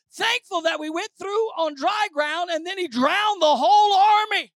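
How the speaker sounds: high, loud, exhorting sermon voice; preaching at full force. Real, not acted. Preacher urging thankfulness for deliverance from bondage, full-force preaching. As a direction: belt out the reasons to praise.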